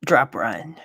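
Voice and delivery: ordinary talk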